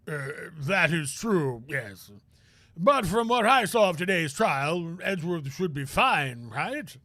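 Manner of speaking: lightly voiced slow old gentleman; musing old man, unhurried; old lawyer voice. Grossberg voiced in game.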